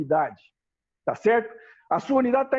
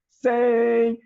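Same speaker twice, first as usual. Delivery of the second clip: drill command. Shown as a demonstration. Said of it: "E aí ele completa a palavra". ("Sem" gritado e sustentado como advertência)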